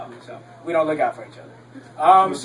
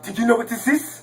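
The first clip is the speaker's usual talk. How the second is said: higher-pitched imitated Nigerian accent; 9 semitones higher than usual. African church guest showing off a rain stick, Nigerian accent. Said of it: "this African group from Nigeria".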